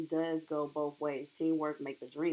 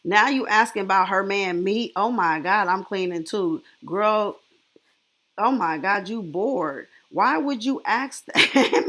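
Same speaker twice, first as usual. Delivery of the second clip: excited loud; 2 semitones higher, 8 dB louder than usual. Real, not acted. Excited reacting to the live.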